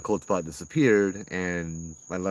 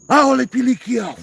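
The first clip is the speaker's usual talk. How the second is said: much higher, much louder mock banishing shout; Hawaiian yell; 13 dB louder than usual. Uncle Joe yelling in Hawaiian at the spirit, dramatic shout. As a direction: bellow it like Uncle Joe chasing off a ghost. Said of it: "yell in Hawaiian at you".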